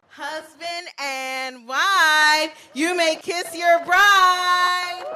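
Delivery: slow, booming and high with joy; loud joyful pronouncement. Real, not acted. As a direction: announce it to the whole crowd. Officiant pronounces couple married.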